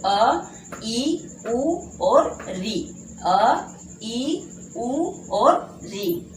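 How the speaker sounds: each short vowel अ, ई, उ, री said slowly and separately, like a teacher; drawn out, with gaps between the vowels; short vowels sounded out. Shown as a demonstration. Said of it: "एक मात्रा का समय लगता है". (धीरे-धीरे हर स्वर अलग बोलकर)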